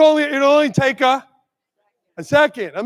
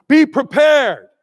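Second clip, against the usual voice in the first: usual speaking voice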